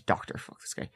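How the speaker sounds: a breathy, hushed insult under the breath; whispered aside. Real, not acted. Host whispers a rude aside to listeners. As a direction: drop to a whisper for the jab.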